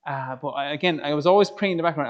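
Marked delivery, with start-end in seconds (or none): none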